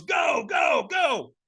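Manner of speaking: high, repeated shouts of command; imitated high-pitched launch cry; urgent shouting. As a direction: urge them off like a screaming trainer. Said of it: "he screamed at me".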